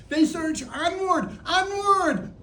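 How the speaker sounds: high, rising urgency of a play-by-play; excited race commentary. Pretend. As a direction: urge the racers on from the commentary box.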